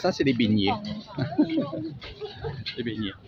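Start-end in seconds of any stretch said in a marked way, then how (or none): 0.8-2.7 high, soft run of giggles; soft chuckle. Real, not acted. Vlogger amused by the fritters, soft high giggle. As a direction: chuckle lightly to yourself.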